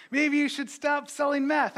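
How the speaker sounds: very high, put-on suggestion; mock tactful pastor. Pastor spitballing at a churchgoer, mock innocent suggestion.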